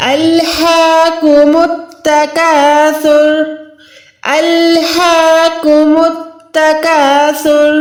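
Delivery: الہاکم with the ha held for a second; slow recitation, long ha in الہاکم; stretched ha. Shown as a demonstration. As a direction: draw out the ha in الہاکم, recited slowly. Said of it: "ہا کو ہم نے ایک second کھینچ کے پڑھنا ہے".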